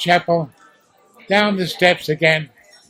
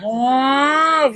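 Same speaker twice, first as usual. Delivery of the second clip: high rising wail like an air-raid siren; siren imitation; 6 semitones higher than usual. Recalling the wartime siren for the interviewer, high wail. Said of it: "so like".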